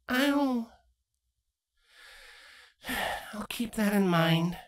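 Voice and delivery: mildly deflated; defeated sigh. Acted. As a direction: give in with a sigh. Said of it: "sighed in defeat".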